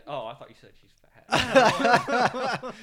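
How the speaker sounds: high, slow laughing line; laughing disbelief. Real, not acted. Mishearing joke, laughing high.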